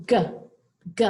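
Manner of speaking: "G" said as a short hard "guh", twice; isolated G sound. Shown as a demonstration. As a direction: pop out "G" as its sound, not its name. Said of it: "It has that G sound".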